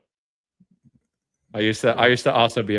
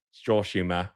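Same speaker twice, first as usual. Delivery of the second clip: lower, put-on British echo; British accent; 7 semitones lower, 5 dB quieter than usual. Co-host echoes friend's remark on dry humor, British accent. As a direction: say it back in British.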